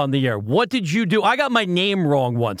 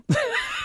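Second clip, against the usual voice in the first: burst of laughter; 5 dB quieter than usual. Real, not acted. Host cracks up on air.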